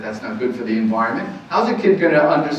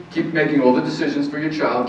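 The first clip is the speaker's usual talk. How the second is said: usual speaking voice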